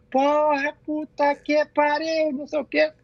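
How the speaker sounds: mock yell, pitched high and held level; high, slow mock holler; retold yell. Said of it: "quando eu gritei assim".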